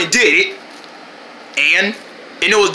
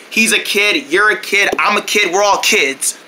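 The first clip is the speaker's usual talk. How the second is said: ordinary talk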